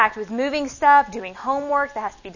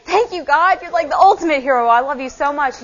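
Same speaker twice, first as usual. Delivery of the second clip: higher and louder, gushing gratitude; excited thanks; 5 semitones higher, 6 dB louder than usual. Retold or imitated. Retelling her thanks to God, high and gushing. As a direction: burst out thanking God like a fan.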